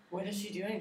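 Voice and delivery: whispered, spooked question; soft, mock-spooked whisper; gasping whisper. Real, not acted. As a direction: hush as if the house is haunted. Spooked by ghost cat story.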